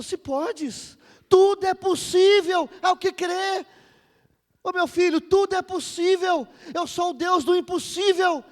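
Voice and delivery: high, very loud divine declaration; booming, impassioned proclamation; full-force preaching. Acted. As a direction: thunder out God's promise to the crowd. Voices father and Jesus, preaching.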